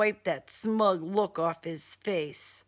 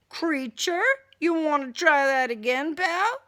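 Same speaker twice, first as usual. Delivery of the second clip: louder, higher threat; angry challenge; 7 semitones higher, 6 dB louder than usual. Acted. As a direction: square up to him, fists raised. Heroine squares up, fist raised.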